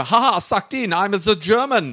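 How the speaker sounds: put-on gloating, high German accent. Spy mocking the English person he fooled, high German accent. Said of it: "That was a German accent".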